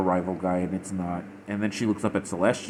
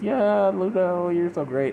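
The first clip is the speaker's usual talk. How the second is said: gushing fangirl; 10 semitones higher, 3 dB louder than usual. Retold or imitated. Gushing over Luna on screen.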